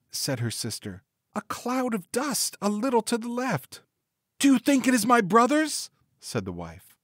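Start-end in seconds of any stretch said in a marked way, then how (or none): 1.3-3.8 high-pitched storybook sister; watchful sister Anne. A narrator's reading. Sister reports from the tower. 4.4-5.9 very high-pitched lady's question; hopeful wife. A narrator's reading. Wife asks sister anxiously.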